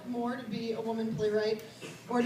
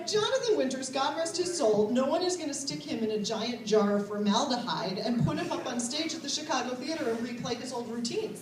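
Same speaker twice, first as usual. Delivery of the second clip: ordinary talk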